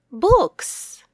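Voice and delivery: ordinary delivery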